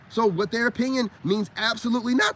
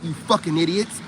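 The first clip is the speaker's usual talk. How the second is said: ordinary delivery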